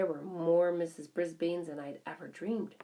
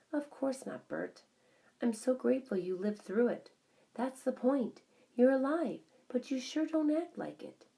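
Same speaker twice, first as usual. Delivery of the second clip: higher, pressing; earnest plea; 8 semitones higher, 3 dB louder than usual. A narrator's reading. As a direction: make him see it. Wife pleads with husband.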